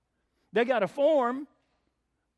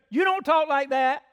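loud, high heckling objection; retold yell; 6 semitones higher, 9 dB louder than usual. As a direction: imitate someone yelling at the preacher. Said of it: "just yelled it out".

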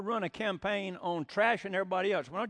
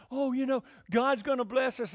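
mocking false prophet; 6 semitones higher than usual. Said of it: "when the false prophet said".

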